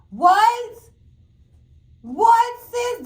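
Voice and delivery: high, loud disbelieving what; loud high shocked what. Real, not acted. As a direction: cry out what in outrage. Disbelief at the women.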